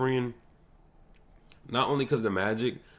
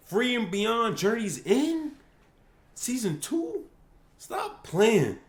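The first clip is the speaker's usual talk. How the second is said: very high, slow, thrilled; squealing excitement; 12 semitones higher, slower than usual. Real, not acted. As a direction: voice cracks up high with joy. Season two announcement.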